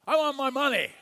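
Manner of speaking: mock angry debt collector; angry demand. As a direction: demand the money with menace. Servant choking a debtor.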